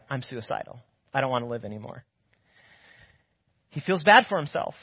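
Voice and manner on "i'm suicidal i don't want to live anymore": low, much softer hopeless voice; despairing mope. Retold or imitated. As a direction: let the voice sink into despair. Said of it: "and he's like".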